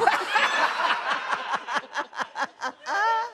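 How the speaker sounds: long high-pitched laughing fit; cracking up high; high laughter. Real, not acted. Breaks up laughing mid-reading.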